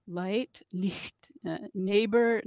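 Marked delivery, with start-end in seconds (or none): 0.7-1.3 "Licht" with a soft hissed German ch; German pronunciation. Shown as a demonstration. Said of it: "some words in German".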